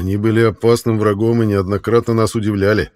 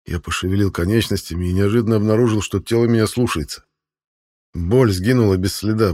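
ordinary delivery, nothing marked

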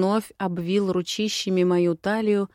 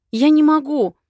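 high, loud anguished cry; desperate outburst, voice leaping up; distressed refusal; 8 semitones higher, 9 dB louder than usual. A narrator's reading. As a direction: cry out as you break away.

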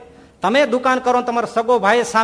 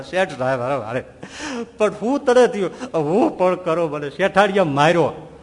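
mock sobbing servant; 3 semitones lower than usual. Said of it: "ધ્રુસકે ધ્રુસકે રડવા બેઠો".

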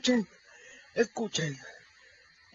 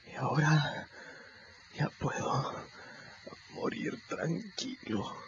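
fading; 2 semitones lower, 8 dB quieter than usual. Acted. Wounded boy fading after confession.

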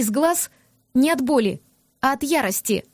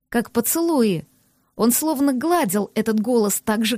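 ordinary delivery

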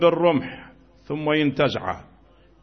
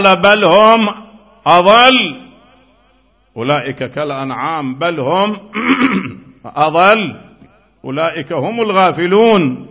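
preach; 4 semitones higher, 8 dB louder than usual. Acted. Quotes Quran with force.

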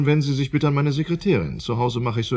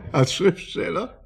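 laughing, slow and higher; mocking laugh; 7 semitones higher, slower than usual. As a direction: scoff through a laugh. Professor lacht spöttisch. (spöttisch lachend)

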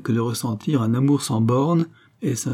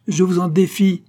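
high, loud dare; defiant challenge; 8 semitones higher, 5 dB louder than usual. Acted. Lady dares the suitor to speak, defiant. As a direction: fling down the challenge, voice raised.

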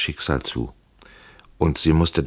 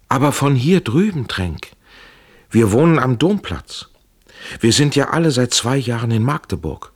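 high, raised voice, pressing the news; urgent calling; 9 semitones higher, 5 dB louder than usual. A narrator's reading. Woman tells prisoner she lives nearby, urgent calling. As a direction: call it up insistently.

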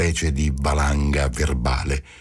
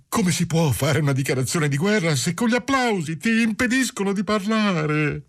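high-pitched madman pleading tearfully; 13 semitones higher than usual. A narrator's reading. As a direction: wail it like a tearful complaint, far above your usual pitch. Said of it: "domandò con voce di pianto".

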